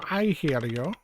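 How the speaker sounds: rough attempt at a German accent; lightly put-on German accent. Doctor answering the police, rough German accent. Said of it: "like, German accent".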